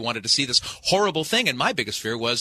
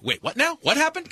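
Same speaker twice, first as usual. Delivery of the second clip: mock surprise. Council members suddenly noticing.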